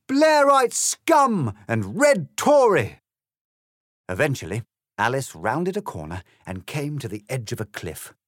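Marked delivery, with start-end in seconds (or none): 0.1-3.0 slow, very high, very loud chant of abuse; shouting. A narrator's reading. The Momentums shouting abuse as they chase Alice. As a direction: yell it as a charging mob.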